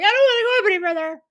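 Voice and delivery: high, loud, rushed protest; panicky protest. Retold or imitated. Patient balking at scooting up the bed, panicky protest. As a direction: blurt it out in a high, hurried voice.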